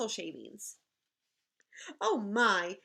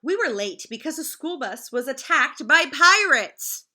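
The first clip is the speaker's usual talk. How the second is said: louder, an eager excuse; loud kid excuse; 9 dB louder than usual. Acted. Teacher reading to her class, loud kid excuse. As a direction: sell the wild excuse loudly. Kids' excuse acted in story.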